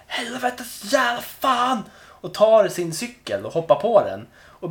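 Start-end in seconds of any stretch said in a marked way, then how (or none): 0.1-1.8 drawn-out, high-pitched cursing howl; imitated furious bellow, stretched out; mock roar. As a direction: roar it out slowly like an enraged man. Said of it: "bara vrålar".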